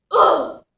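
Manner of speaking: loud, exasperated "ugh"; disgusted groan. Acted. Goddess revolted by her pathetic priest, disgusted groan.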